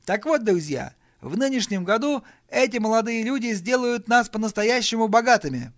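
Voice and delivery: high, loud promise to the room; rousing speech. Acted. Brian promises his relatives riches, high rousing speech. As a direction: sell the big prospect to them.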